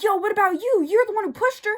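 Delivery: loud, high teasing comeback; mock accusation. Imitates own teasing comeback.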